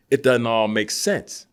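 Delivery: ordinary talk, nothing marked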